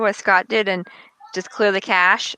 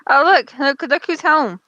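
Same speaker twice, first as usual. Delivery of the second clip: high, bright, excited call; excited look who's home; 6 semitones higher, 4 dB louder than usual. Real, not acted. Excited at someone arriving.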